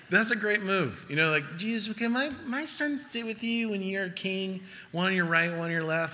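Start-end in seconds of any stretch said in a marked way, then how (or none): 1.6-6.1 high put-on mom voice, easing down; wheedling mother. Mother asking Jesus to honor her sons, high mock wheedling. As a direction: imitate a mom sweetly angling for a favour.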